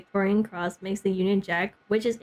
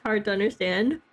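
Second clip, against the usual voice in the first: ordinary talk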